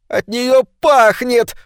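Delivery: высоко и медленно, со стоном; простони с отвращением. A narrator's reading. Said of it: "простонала Маргарет". (Маргарет стонет: высокий, медленный стон)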